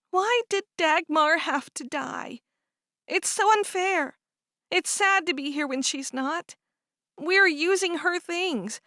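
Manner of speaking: grieving a lost sister, slowly; mild grief. Acted. Cherish grieving her sister Dagmar.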